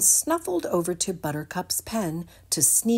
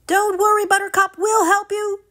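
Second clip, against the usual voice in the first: high, loud encouraging call; reassuring shout; 8 dB louder than usual. Acted. The animals calling to stuck Buttercup, loud and reassuring. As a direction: shout comfort to her. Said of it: "the animals all shouted".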